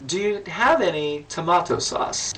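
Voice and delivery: put-on slow, lightly done Russian accent. Host retelling the Russian guest's question, in a light Russian accent. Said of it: "Only in a Russian accent".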